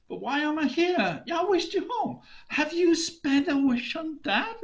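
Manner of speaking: high, loud bickering; heated argument. Acted. Husband and wife bicker over wishes, heated. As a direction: snap back and forth. Couple arguing.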